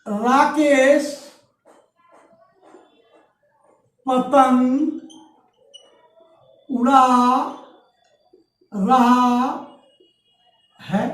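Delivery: Rakesh drawn out slowly with a rise, then word by word with long gaps; word-by-word dictation with pauses. Shown as a demonstration. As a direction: dictate each word slowly for students to write. Teacher dictating an example sentence.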